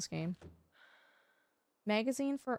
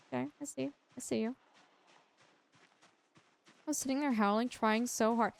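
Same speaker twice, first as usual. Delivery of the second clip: usual speaking voice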